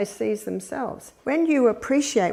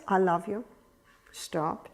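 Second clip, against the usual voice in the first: soft, low, loving then firm; reassure, then gently stop them; tender hush; 3 semitones lower, 5 dB quieter than usual. Shown as a demonstration. Parent smiling at a crying baby, tender and soft. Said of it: "You know what the smile says?".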